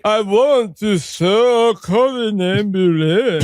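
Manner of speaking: very slow, slurred Dracula; Dracula mid-stroke. Acted. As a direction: drag out a Dracula accent as if having a stroke. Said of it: "Dracula mid-stroke for his accent".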